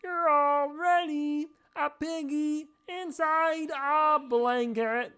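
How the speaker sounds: high, loud and slow frog puppet. Pretend. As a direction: tease her slowly as a squeaky frog. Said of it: "I get stuck in the voice".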